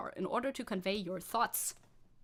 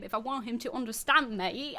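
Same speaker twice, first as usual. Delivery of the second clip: normal talking voice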